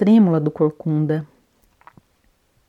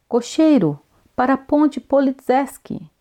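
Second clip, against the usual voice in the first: muffled shout; 7 semitones higher than usual. A narrator's reading. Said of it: "grita o corcunda com voz surda".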